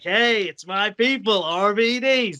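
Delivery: a hint of Rob Van Dam hamming it up for the crowd; cocky star voice. Rob Van Dam greeting his hometown crowd, lightly voiced slow showman. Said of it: "Van Dam's like".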